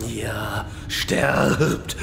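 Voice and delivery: gloating boss voice with a cackle; villain boss. Acted. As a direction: menace the dying heroes. Boss Illidan killing raiders.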